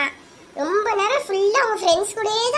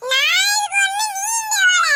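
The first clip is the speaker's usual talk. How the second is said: much higher, loud defiant shout; shouting back; 13 semitones higher, 6 dB louder, slower than usual. Acted. Brother refusing his sister in a fight, loud shouting. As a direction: yell it back stubbornly. Shouted quarrel between siblings.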